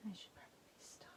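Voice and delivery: whispered aside to herself; soft unvoiced whisper. Real, not acted. Quiet aside while painting.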